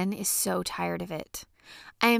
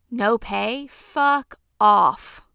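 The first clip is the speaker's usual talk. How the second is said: mock brush-off; 6 dB louder, much slower than usual. Mocking a job posting.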